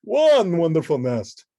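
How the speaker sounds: loud, slow delighted count; cried out. Acted. The Count tallying a nest, loud and slow. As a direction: proclaim the count with relish. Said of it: "cried the Count".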